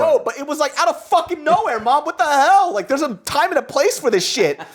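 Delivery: high-pitched, incredulous rant; comic outburst of disbelief at a parent; mock exasperation. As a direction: scold in a high, worked-up voice. Acted outburst at mom.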